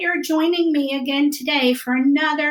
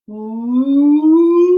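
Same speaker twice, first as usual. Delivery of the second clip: loud whale call; whale sound; 7 dB louder than usual. Shown as a demonstration. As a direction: bellow like a whale. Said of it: "It might go something like this".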